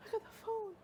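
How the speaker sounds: high, overwhelmed retelling; high, tearful-sounding re-enactment; retold shaky excitement. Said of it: "I was screaming, and I'm shaking".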